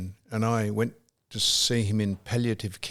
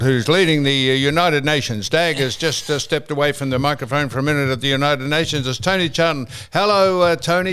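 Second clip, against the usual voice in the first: fast, high, loud radio host patter; Tony Charlton interview voice; 4 semitones higher, 6 dB louder than usual. Retold or imitated. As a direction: rattle through guests like a busy radio interviewer. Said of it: "he'd say".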